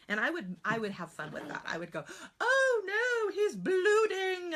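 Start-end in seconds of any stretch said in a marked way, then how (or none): 2.4-4.5 high, loud, slow mock wail; wacky weird voice. Shown as a demonstration. Teacher hams it up for her class, squealing wacky and high. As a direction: go weird and silly for the kids. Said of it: "and I would just go weird".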